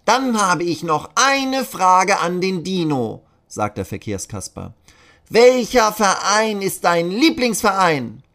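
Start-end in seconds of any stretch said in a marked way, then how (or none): 0.0-3.2 very high, loud puppet voice; a squeaky Punch puppet addressing kids; Kasper puppet voice. Acted. Traffic puppet speaks. 5.3-8.2 very high, loud puppet voice; a squeaky Punch puppet addressing kids; Kasper puppet voice. Acted. Traffic puppet asks dino.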